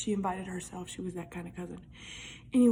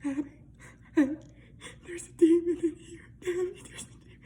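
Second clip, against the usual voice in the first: higher, tearful mock-panic call; retold frightened cry; 8 semitones higher, 4 dB louder than usual. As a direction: plead with Abby that a demon is here. Waking cousin, demon in room.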